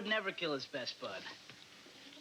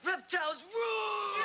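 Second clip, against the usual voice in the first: pumped-up classroom yell; shout; 13 semitones higher than usual. Acted. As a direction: yell it like a cheer.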